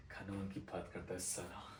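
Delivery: very low, soft aside; contemptuous mutter. Acted. Lawyer muttering about his opponent who talks of law, contemptuous. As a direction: curse him under your breath.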